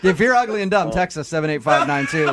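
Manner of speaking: giggling while teasing the listeners; laughing through it. Acted. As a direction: push the words out through laughter. Host mocking listeners, laughing.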